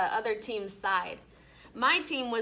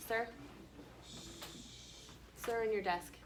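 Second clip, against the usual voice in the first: quiet aside; 4 semitones higher, 15 dB quieter than usual. Real, not acted. Real teacher addressing child aside.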